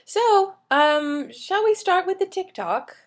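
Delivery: high, lilting British presenter voice; British accent. Acted. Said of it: "It's always in a British accent".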